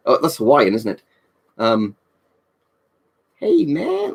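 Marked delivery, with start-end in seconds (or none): 3.3-4.1 very high-pitched stoner character; game character impression. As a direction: greet like a laid-back video-game stoner, pitched way up. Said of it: "the guy from the Freedom Base in Clear Sky".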